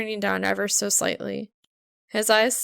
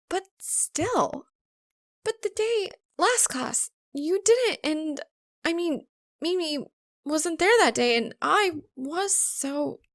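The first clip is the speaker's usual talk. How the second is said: put-on higher, halting girl voice; flustered stammer; 8 semitones higher, slower than usual. A narrator's reading. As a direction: trip over the words in disbelief. Maka stammering disbelief.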